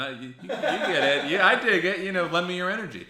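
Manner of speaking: laughing, high and loud; laughing while talking. Real, not acted. Laughing agreement.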